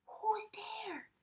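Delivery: high, small frightened mouse voice; a tiny startled call into the dark; mouse crying out. A narrator's reading. Mouse calling out at glowing eyes, high and scared. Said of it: "Mouse cries".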